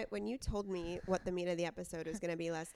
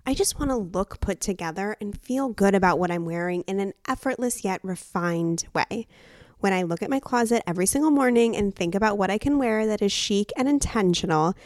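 loud, bright sponsor read; 15 dB louder than usual. Acted. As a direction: sell it like a podcast ad. Reads sponsor ad.

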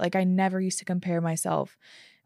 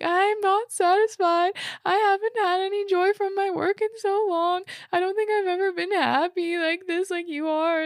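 bawling; 12 semitones higher than usual. Retold or imitated. Wife sobbing to her husband about her joyless work, wailing. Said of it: "like, bawling".

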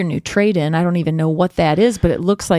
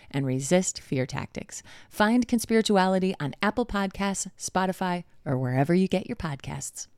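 softer, measured promo read; podcast ad read; 8 dB quieter than usual. Real, not acted. Host telling listeners about a podcast, ad read. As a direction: recommend it in an even, quieter read.

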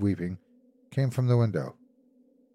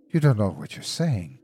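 ordinary delivery, nothing marked

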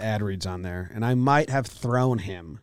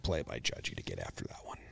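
breathy whisper of a manager's praise; whispered mock quote; 16 dB quieter than usual. Mocking the manager's praise in a whisper. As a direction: hush it like a secret joke.

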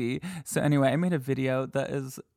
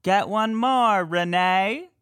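much higher and louder ghost mimicry; mock EVP voice; 6 semitones higher, 4 dB louder, slower than usual. Host offering a guess to listeners, high mock ghost voice. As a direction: sing out the option like the recording. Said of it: "They believe it says C".

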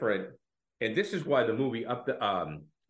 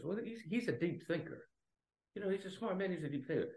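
softer, higher, put-on voice; lightly voiced pub friend praising a thinker; mock earnest praise; 6 semitones higher, 8 dB quieter than usual. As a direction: say it gently as if admiring. Voicing Gleeson praising a friend.

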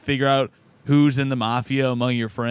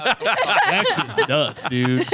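laughing; 4 semitones higher, much slower than usual. Real, not acted. Laughing about a friend.

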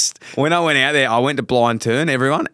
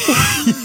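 a sudden high laugh; high burst of laughter; 13 semitones higher than usual. Real, not acted. Teammate cracking up at a draft pick joke, high burst of laughter.

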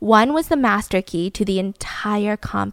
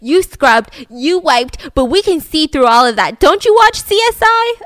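imitating a detective in his face, louder; mock-aggressive taunt rising to a high jab; detective getting in the suspect's face, raised voice; intense accusation; 5 semitones higher, 6 dB louder than usual. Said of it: "Like, really intense".

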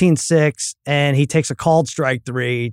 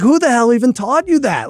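exasperated dad; 9 semitones higher than usual. Retold or imitated.

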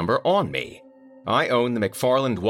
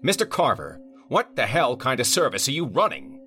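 angry client; 9 semitones higher than usual. A narrator's reading. Vineyard owner complaining to the manager, irate. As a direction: demand answers, fed up.

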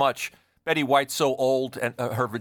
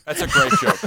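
very high, louder squeal of delight; 6 dB louder than usual. Real, not acted. Host reacting to the fire joke, very high delighted squeal. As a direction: squeal the line out in glee.

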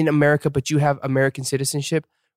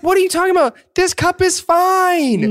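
much higher, loud, stretched out protest; high indignant squeal; 5 dB louder than usual. Retold or imitated. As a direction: squeal it like you're offended. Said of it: "And I was like".

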